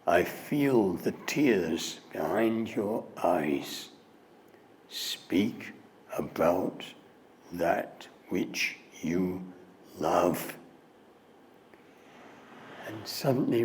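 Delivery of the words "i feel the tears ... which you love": mystic voice. Retold or imitated. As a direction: speak like a soothing mystic. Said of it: "the voice".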